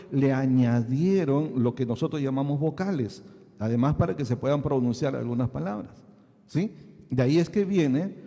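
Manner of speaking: ordinary talk, nothing marked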